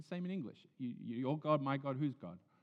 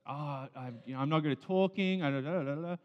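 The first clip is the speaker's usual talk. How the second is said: flustered reluctant Moses, louder; Moses making excuses; 4 semitones higher, 7 dB louder than usual. Retold or imitated. Moses pleading with God to get out of it. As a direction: stammer out excuses, higher and louder. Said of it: "He's like".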